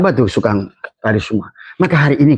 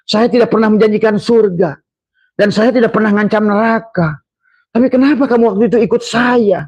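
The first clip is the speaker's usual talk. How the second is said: much higher, mocking devil voice; Iblis speaking; 10 semitones higher than usual. Preacher voicing Iblis mocking his followers, higher and taunting. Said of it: "Kata iblis".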